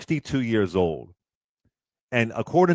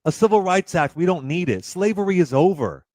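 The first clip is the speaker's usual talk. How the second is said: lightly voiced smug conservative, a touch higher; mock conservative voice; 6 semitones higher than usual. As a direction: parody them saying it's all fixed. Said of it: "conservatives saying".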